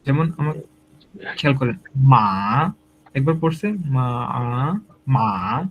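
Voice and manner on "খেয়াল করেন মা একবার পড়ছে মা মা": মা dipping low then rising; slow dipping মা; third tone. Shown as a demonstration. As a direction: let মা fall and climb. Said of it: "নিচে আসবে আবার উপরে যাবে".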